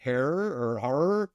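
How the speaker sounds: "Harer or Harer" with two different first vowels; "Harer or Harer", each name in two syllables; trying out pronunciations. Shown as a demonstration. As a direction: draw out both guesses in "Harer or Harer". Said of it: "we were trying to pronounce this earlier".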